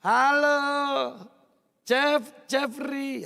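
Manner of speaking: loud friendly "Halo", then "Jeff" before the full "Jeffrey"; Javanese way of calling. Shown as a demonstration. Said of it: "Ya, orang Jawa itu".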